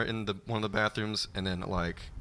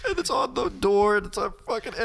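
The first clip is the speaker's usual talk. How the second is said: high, loud, excited telling; 11 semitones higher, 5 dB louder, faster than usual. Real, not acted. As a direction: tell it half laughing. Laughing while telling.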